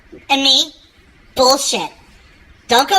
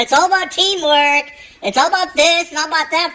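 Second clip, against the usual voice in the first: mock lecturing; 6 semitones higher than usual. Mocking his own teamwork lecture.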